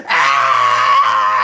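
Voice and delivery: loud, low-pitched yell of exasperation; hammed-up roar of frustration; frustrated scream. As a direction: let out a long, loud mock-angry scream. Climax of mock outrage.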